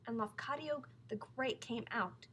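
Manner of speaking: ordinary delivery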